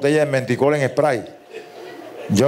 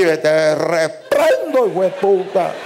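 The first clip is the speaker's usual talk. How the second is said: exasperated rebuke; slower than usual. Acted. Asistente se desespera.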